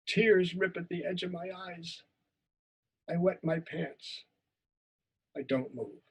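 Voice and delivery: acted first-person story of a shy boy; a teacher acting out a memory story; story performance. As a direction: tell it as the scared twelve-year-old. Performs a story monologue for the class.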